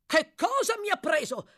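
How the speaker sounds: high, loud, outraged retort; indignant exclamation. A narrator's reading. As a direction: throw the question back furiously. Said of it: "esclamò l'altro". (esclamazione indignata)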